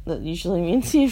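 ordinary delivery

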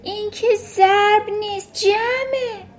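shrieking tyrant of a headmistress; Trunchbull yelling. A narrator's reading. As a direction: bellow it down at the boy. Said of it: "ترانچبول فریاد کشید".

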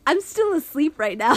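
high, loud, stunned denial; hammed-up disbelief. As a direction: insist it's a dream, playfully overdone. Retold disbelief at waking.

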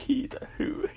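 high, giggly words squeezed out between laughs; a high, giggling aside; laughing through it. Acted. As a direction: try to speak while cracking up. Laughing about Uncle Kenny.

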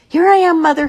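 put-on very high, loud call of a baby bird; chick calling out. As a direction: shout up to the plane as a tiny chick. Baby bird calls to plane.

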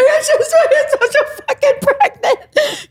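very high, wailed confession; mock bawling. Daughter bawling to stepdad on the phone, high hammed-up wail. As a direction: squeal it out through exaggerated sobs. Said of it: "I just started fucking bawling my eyes out".

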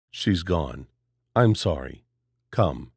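low, gentle and steady; trusted, comforting voice. A narrator's reading. Stranger comforting Jenna Lee after Clara is taken, low gentle voice. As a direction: settle her with a low calm voice. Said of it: "he said in a voice that Jenna Lee trusted".

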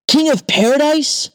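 very high astonished question; amazed cry. A narrator's reading. King hearing of paradise, very high amazed cry. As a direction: shriek it in disbelief. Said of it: "the king cries out".

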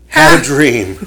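ordinary delivery

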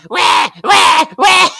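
high, loud mock baby wail; baby crying. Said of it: "the baby's like".

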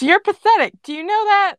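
high, loud mockery; scornful taunt. A narrator's reading. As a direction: belittle a beaten opponent. Grape taunts opponent in story.

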